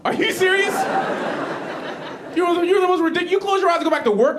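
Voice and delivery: high, loud disbelief; incredulous outburst. Acted. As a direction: blast him for the nonsense. Mocking friend's dream logic.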